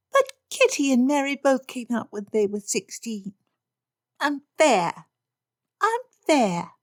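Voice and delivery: put-on high, petulant girl voice; girlish whine. Lydia protests to Papa, high petulant whine. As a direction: stamp your foot in words.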